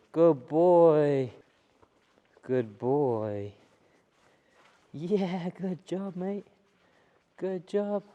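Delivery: high, loud encouraging praise for a dog. Real, not acted. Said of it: "that nice tone of voice".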